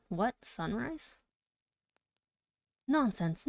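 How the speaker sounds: ordinary delivery, nothing marked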